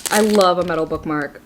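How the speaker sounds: usual speaking voice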